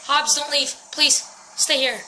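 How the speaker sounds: usual speaking voice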